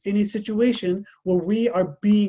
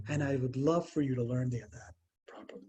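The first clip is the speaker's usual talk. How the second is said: gentle invitation; 5 semitones lower, 8 dB quieter than usual. Retold or imitated. Prophet invites youth to learn adhan.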